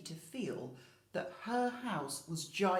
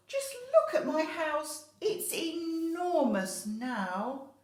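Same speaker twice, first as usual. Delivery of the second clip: higher, louder, slow drawn-out wonder; old lady thrilled with her big house; delighted old lady; 9 semitones higher, 7 dB louder, slower than usual. Acted. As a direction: marvel at the space.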